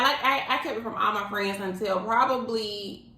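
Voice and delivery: usual speaking voice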